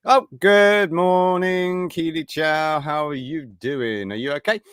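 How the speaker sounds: high, drawn-out sing-song hello; cheery greeting. Real, not acted. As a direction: greet the viewer brightly, stretching the words.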